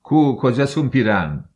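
loud, high furious shout; enraged yell. A narrator's reading. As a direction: roar like a wounded bear. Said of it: "مثل خرس تیر خورده دیوانه شده بودم".

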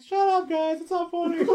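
high, whiny protest impression; whiny coworker. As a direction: whine it in a high voice. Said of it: "He's like".

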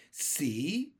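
mild storybook shout, rising. A narrator's reading. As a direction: throw the word back as an offended earthworm. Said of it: "shouted the poor earthworm".

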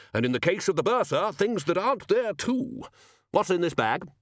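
high-pitched, lilting wizard; hearty Arch-Chancellor. Acted. Wizard questioning the gnome about the bag, hearty. Ridcully speaks.

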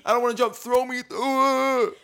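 put-on high, loud drunk fan cheer; rowdy Bills fan. Fan at a Bills tailgate egging on the crowd, rowdy yell. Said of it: "I'm like".

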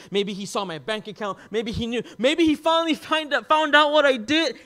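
mock panic. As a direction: spiral into hammed-up worry, building to a near shout. Acts out panicked guessing after text.

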